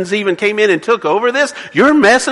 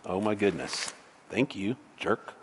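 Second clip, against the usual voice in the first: much softer, under the breath; a near-silent, exasperated mutter; muttered aside; 8 semitones lower, 17 dB quieter than usual. Real, not acted. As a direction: grumble at the stubborn Bible. Bible won't cooperate, muttered aside.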